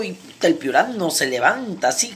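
normal talking voice

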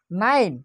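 নাইন with a long "ai" glide and silent e. Shown as a demonstration. Teacher showing i-consonant-e to students, নাইন said with "ai". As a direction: say নাইন loudly as "nain". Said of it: "উচ্চারণটা হবে আয়ের মতো".

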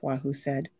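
plain reading voice

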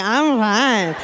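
imitated drunken slur. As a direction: play a groggy drunk insisting. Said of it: "she pops up and she's drunk".

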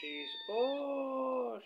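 excited coo. Real, not acted. Gushing over the cat.

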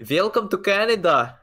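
put-on hearty Russian accent, opening high and loud; mock Russian accent. Welcoming a comrade to Canada, mock Russian accent. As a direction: greet them as a hammed-up Russian. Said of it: "like a Russian starting to become Canadian".

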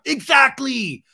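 high, loud mock outburst; hammed-up shout; exasperated yell. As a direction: burst out in exasperation. Acted Tom's tantrum.

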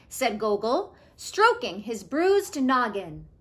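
put-on lively storytime read to kids; story narration. A narrator's reading. Teacher reading a picture book to her class, lively storytelling.

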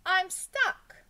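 high, loud cry for help; schoolboy's alarmed call. A narrator's reading. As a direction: announce trouble loudly to the teacher. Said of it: "he called".

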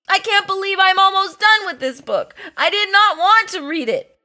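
high, hurried whine of complaint; exasperated protest. Acted. Near the end of hated book.

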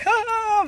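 very high forced nervous laugh; fake laugh. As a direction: laugh to hide the fear. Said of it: "when you laugh, you're like".

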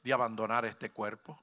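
very soft, overly polite plea to the devil; mock politeness. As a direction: ask the devil sarcastically nicely. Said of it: "Usted tiene que decirle".

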